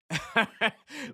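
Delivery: high, loud burst of laughter; short, loud chuckle; incredulous laugh. Real, not acted. Host on beefing with an LA rapper, incredulous laugh.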